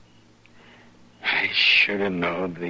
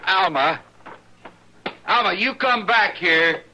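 loud, higher call after her; 10 semitones higher, 3 dB louder than usual. Acted. Husband calls after his wife as she walks out, loud and pleading. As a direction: shout her name to stop her leaving.

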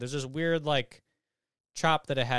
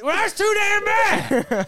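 high, drawn-out mock yell; hammed-up movie shout; 9 dB louder, much slower than usual. Friend quoting the movie to friends, hammed-up yell. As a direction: holler it as an over-the-top film impression. Acting out a film line.

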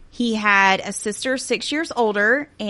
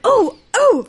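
game show host; 8 dB louder than usual. Retold or imitated. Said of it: "But I was like".

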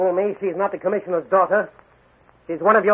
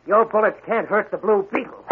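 ordinary talk, nothing marked

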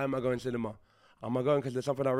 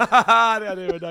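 laughing through the words; giggling, slow and high; 9 semitones higher, 13 dB louder, slower than usual. Real, not acted. Laughing at own mistake.

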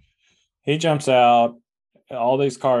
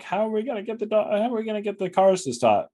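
much higher-pitched mock puzzlement; worried dogs' voice; 12 semitones higher, faster than usual. Dogs wondering how to stop the cars, high imitating voice. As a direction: voice the bewildered group, pitched way up. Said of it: "they're like".

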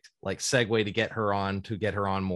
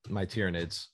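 normal talking voice

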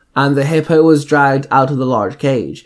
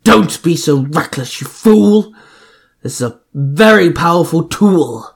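barking; 3 semitones higher than usual. Acted. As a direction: scold him like a fool. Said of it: "Loki barked".

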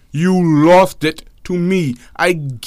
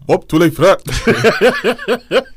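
laughing; much slower than usual. Real, not acted. Teasing co-host, laughing.

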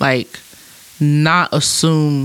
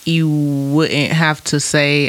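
normal talking voice

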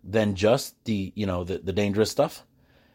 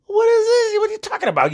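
much higher, louder, stunned outburst; shocked disbelief; 5 dB louder than usual. Retold or imitated. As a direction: blurt it out, totally floored. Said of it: "I'm like".

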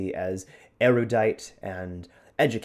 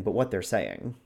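usual speaking voice

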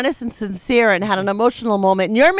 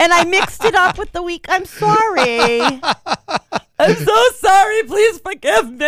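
high, hammed-up tearful apology; mock crying; 5 semitones higher than usual. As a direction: playfully pretend to cry to win forgiveness. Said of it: "You're crying now?".

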